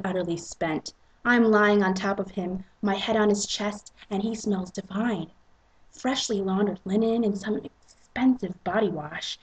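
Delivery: ordinary delivery, nothing marked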